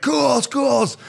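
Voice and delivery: high, loud mock call across the suite. Friend hollers the buddy's name in a video, loud. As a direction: shout his name like a drunk fan.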